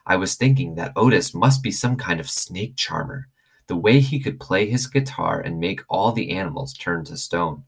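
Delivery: ordinary delivery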